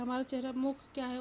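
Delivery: usual speaking voice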